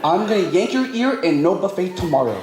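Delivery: put-on high-pitched scolding mom in a Filipino accent. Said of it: "So I wanna do that accent".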